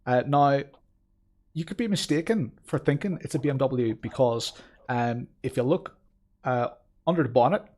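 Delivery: put-on halting, lilting amateur Belfast accent. Streamer introducing himself and his car, Belfast accent. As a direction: put on a wobbly Belfast accent, searching for words. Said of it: "I think my Belfast accent is actually".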